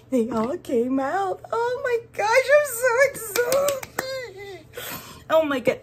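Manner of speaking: very high, loud excited squeal; real giddy, high-pitched excitement; all excited. Real, not acted. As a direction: squeal with delight as it all comes out. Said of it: "I'm getting all excited about this".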